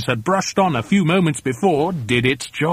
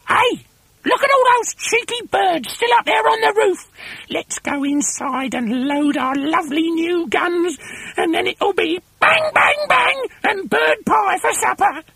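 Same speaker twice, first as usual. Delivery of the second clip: very high-pitched, excited shrieking; piercingly high storybook villainess; gleeful screechy wife. A narrator's reading.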